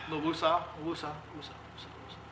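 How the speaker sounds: fading woosah down to an unvoiced breath; calming breath. Real, not acted. Calming himself after ranting, fading woosah. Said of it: "Gotta take a breath".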